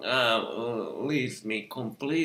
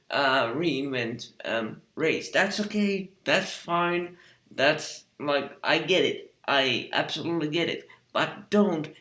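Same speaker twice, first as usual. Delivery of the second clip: ordinary delivery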